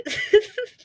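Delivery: a sudden high-pitched giggle; high squealing laugh. Real, not acted. Laughing at own story.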